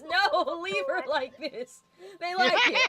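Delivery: excited mock plea. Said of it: "fucking N X lover one says".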